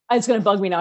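ordinary talk

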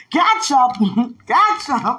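high, loud repeated word broken by laughter; hammed-up incredulous cry, laughing; mock outcry. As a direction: exclaim it in laughing mock horror. Mocking the ketchup incident, laughing.

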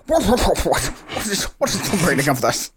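high-pitched, stammering outrage of a fussy clock; indignant sputtering. Acted. Clock grabbed off the shelf protests, high indignant sputtering.

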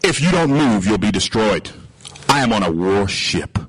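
low, measured warning; threatening captain. Acted. Warship captain warning a seaman, gravely threatening. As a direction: lay down a threat, voice dropped. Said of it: "so he says".

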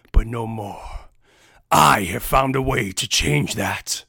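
loud, slow villain monologue; sneer, then rally the orcs; gloating orc warlord. Acted. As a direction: Jaldar mocks Brachus and reveals his plan.

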